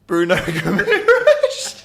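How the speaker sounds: slow laughing emphasis on Bruno Guimaraes; drawn-out teasing name. Acted. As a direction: stretch the name out as a gleeful punchline. Teasing punchline to guest.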